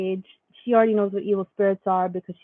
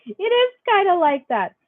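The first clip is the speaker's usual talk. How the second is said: amused; 10 semitones higher than usual. Real, not acted. Repeating her own reply, higher.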